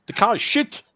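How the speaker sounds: high-pitched mock Austrian German accent; Niki Lauda's Austrian accent. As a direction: driver to his team, in a hammed-up Austrian German accent. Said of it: "the impatient Austrian slash German who just doesn't".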